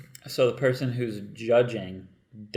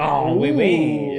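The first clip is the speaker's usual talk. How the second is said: put-on French accent; 4 semitones higher, 7 dB louder, much slower than usual. French accent talk, sexy chick.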